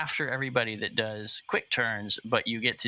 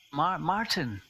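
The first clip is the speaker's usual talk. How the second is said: nervous character voice; 8 semitones higher than usual. Acted. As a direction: call the name with a slight stammer. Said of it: "he knocks again".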